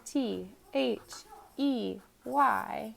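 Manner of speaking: slow, T H E Y letter by letter; staccato spelling. Shown as a demonstration. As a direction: spell each letter apart. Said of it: "snap word".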